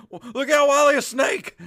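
high, loud warning yell; alarmed shout. Retold or imitated. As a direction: shout a sudden snake warning. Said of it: "was about to be like".

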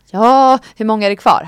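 loud, strained retold reply; strained outburst. Patient asks doctor how many stitches remain, loud and strained. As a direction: blurt it loudly through imagined pain.

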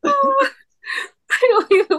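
laughing tears. Real, not acted.

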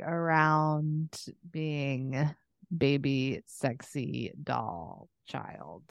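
ordinary delivery

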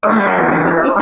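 drawn-out mock growl; hammed-up possessed noise; imitated possessed groan. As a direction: imitate a man groaning as a spirit takes him over. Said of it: "And he was going".

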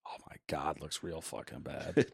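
hushed whisper of disgust; whispered dismay. Real, not acted. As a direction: breathe it out as a horrified whisper. Reacting to Skull and Bones.

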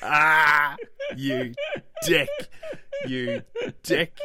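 high laughing shout of you dick; mock outraged yell. Wife yelling at her husband, laughing and outraged. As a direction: yell it at him, cracking up with laughter.

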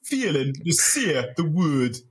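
slow, deliberate voiceover impression; drawn-out reality-show narrator; Big Brother narrator. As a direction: narrate the failure, stretching every word. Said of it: "Big Brother's voice".

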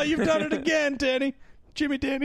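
high, laughing put-on gush; squeaky fake fawning laughter; mock hysterical amusement. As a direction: crack up at a terrible joke, hammed-up. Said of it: "she's laughing hysterically at something".

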